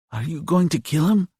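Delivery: put-on high-pitched child's voice; high kid's question, rising at the end; young boy asking. A narrator's reading. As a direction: ask like a boy, pitched well up. Narrator voices young John.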